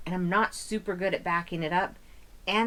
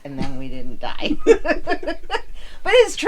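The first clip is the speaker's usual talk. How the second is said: laughing; 3 semitones higher, 10 dB louder than usual. Real, not acted. Laughing through the story.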